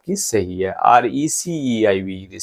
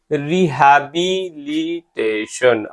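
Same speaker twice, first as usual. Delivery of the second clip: Rehabilitation stretched slowly, each syllable separate; much slower than usual. Shown as a demonstration. Teacher repeating the word to check its spelling, rehabilitation syllable by syllable. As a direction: break rehabilitation into slow, clear syllables.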